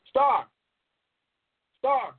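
loud high call across the room; calling out loud to someone far off; yelling. Real, not acted. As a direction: shout the name out to be heard. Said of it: "I'm sorry for yelling, but I had to talk loud".

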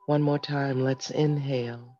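soft, calm breathing guide; guided meditation. Acted. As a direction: lead them gently through each breath. Leads a breathing exercise.

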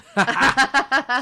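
loud, high burst of laughter. Real, not acted. Host laughing after joking about not being Italian, loud laugh. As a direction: laugh out loud at your own joke.

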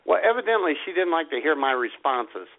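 ordinary talk, nothing marked

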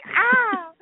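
high, acted yelp of pain; hammed-up "ah" of pain; mock pained scream. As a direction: yelp as if your hair is ripped out. Acting Nacho having hair pulled.

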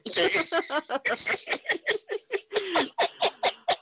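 long high-pitched laughing fit; helpless laughter. Real, not acted. Host cracking up at the caller's remark, helpless laughter.

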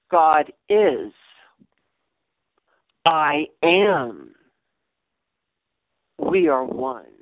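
low, slow, steady mantra; a meditative mantra said with calm weight. Shown as a demonstration. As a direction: repeat it calmly to settle yourself. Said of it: "I say it over and over and over again, calmly".

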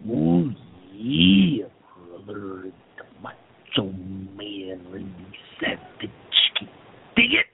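rough growling wrestler voice; Macho Man impression. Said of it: "what the title of the show is".

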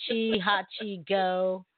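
usual speaking voice

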